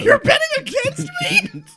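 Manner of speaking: shrill indignant yell; high outraged shout. Acted. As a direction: shout your disbelief. Kid outraged at bet.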